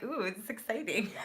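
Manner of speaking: mock excitement. Reader voicing her own goosebumps, playfully excited. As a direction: gush over it with mock thrill. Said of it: "I'm like".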